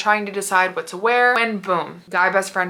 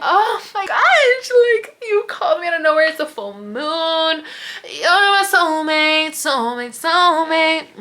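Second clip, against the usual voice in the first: giddy; 9 semitones higher than usual. Retold or imitated. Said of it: "I'm giggling".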